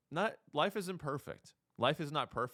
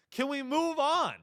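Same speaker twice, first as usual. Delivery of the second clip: insistent repeat; 10 semitones higher, 4 dB louder than usual. Acted.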